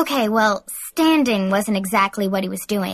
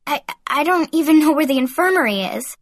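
higher-pitched, flustered stammer; stuttering; 5 semitones higher, 3 dB louder than usual. A narrator's reading. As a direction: stumble over the first word. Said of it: "I stuttered".

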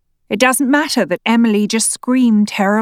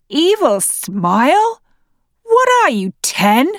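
mocking scorn; 7 semitones higher, 3 dB louder than usual. Husband mocking wife's words.

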